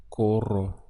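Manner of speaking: corro said with a rolled R; Spanish corro demo. Shown as a demonstration. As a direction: roll the R in corro, stress on CO. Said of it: "like this".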